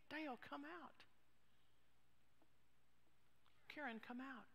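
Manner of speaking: soft call by name, well spaced; gentle summons. Acted. Preacher asks some of you today, gentle quiet summons. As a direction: call each person out quietly.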